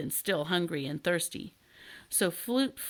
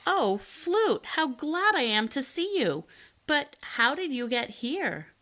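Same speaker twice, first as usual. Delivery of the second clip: glad surprise; 7 semitones higher than usual. A narrator's reading. Elmer greets canary gladly.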